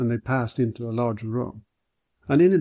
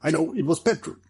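higher-pitched, mild exclamation; eager cry; 4 semitones higher than usual. A narrator's reading. Ricardo guessing what Hanaud smelt, mildly excited. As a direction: blurt out your guess. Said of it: "cried Ricardo".

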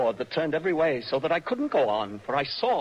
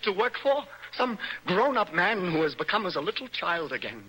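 normal talking voice

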